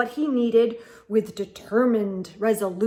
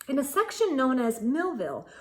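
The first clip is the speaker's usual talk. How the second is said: plain reading voice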